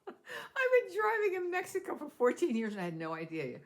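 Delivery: laughing, high-pitched disbelief. Real, not acted. Laughing at herself telling her vlog viewers. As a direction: laugh through the confession.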